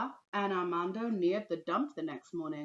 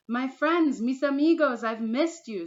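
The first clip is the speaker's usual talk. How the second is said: higher and louder, full of joy; glad greeting; 7 semitones higher, 9 dB louder than usual. Acted. Teacher greets the children, high and loud with joy. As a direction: greet old friends happily.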